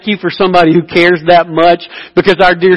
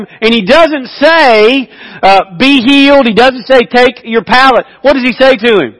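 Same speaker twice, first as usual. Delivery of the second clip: higher, driving sermon voice; preaching at full force; 6 semitones higher, 3 dB louder than usual. Acted. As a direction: build the story with preacher's fire. Preaching the healing story.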